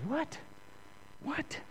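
high, hushed mock disbelief; incredulous reaction. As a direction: squeak a quiet, baffled question. Baffled reaction to inner voice.

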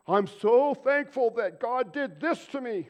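high, loud, slow mocking whine; mock grudging gratitude. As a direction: ham up a fake-pious complainer. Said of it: "everybody's gonna be like".

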